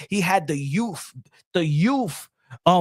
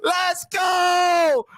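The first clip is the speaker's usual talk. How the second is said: hyped celebration; 8 semitones higher, much slower than usual. Real, not acted. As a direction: celebrate like you just won. Celebrating Trump win, hyped shouting.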